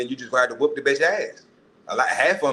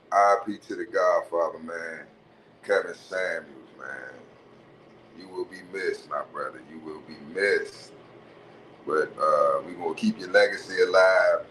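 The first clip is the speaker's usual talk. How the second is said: sincere tribute; 6 semitones lower than usual. Real, not acted. Tribute to late Kevin Samuels.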